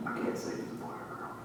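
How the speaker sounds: very soft, unvoiced whisper; hushed secret. Comedian leaking the kid's identity, whispered so the camera misses it. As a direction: slip out a confidence under your breath. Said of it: "That was too quiet".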